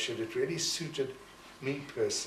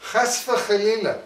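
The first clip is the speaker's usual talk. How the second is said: mock horrified mother; 4 semitones higher, 6 dB louder than usual. Mother's horrified remark retold.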